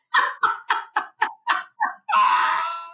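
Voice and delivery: long, high cackling laugh; real hysterical high laughter; helpless laughter. Real, not acted. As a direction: keep cracking up till out of air. Helpless laughter at her own story.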